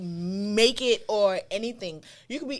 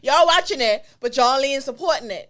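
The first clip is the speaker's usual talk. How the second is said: called out; 3 semitones higher, 8 dB louder than usual. Real, not acted. Calling out followers.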